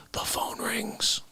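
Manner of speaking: soft hushed whisper. Acted. Telling cohost the phone call, hushed whisper. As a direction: drop to a whisper for the punchline.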